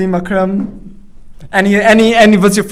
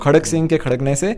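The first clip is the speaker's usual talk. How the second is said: fast, joined-up Hindi phrase; rapid teaching model of the tongue twister; in one go; much faster than usual. Shown as a demonstration. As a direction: run the words together without a break. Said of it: "in one go".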